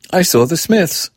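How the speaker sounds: high and eager; insist, still thrilled; insistent child. A narrator's reading. Girl insisting to her mother, eager.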